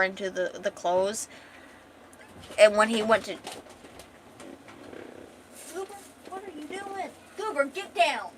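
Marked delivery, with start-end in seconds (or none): 5.7-8.3 high-pitched pet scolding; real, exasperated high call; scolding the cat. Real, not acted. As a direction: call off-mic to a misbehaving cat. Calls out to climbing cat.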